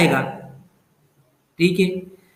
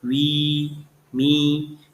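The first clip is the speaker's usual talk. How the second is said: वी and मी each drawn out as its own beat; both syllables stressed; 4 dB quieter, much slower than usual. Shown as a demonstration. Teacher showing a spondee, वी and मी both stressed. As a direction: give वी and मी equal, separate weight. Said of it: "ये दोनों stress हो गए".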